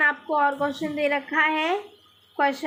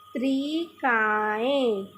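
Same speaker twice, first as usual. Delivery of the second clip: पत्रिकाएं drawn out very slowly while writing, falling at the end; slow dictation; 3 semitones lower, much slower than usual. Shown as a demonstration. Teacher slowly dictating पत्रिकाएं as she writes. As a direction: say पत्रिकाएं slowly for pupils to copy. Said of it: "लिख के दिखा रही हूँ मैं".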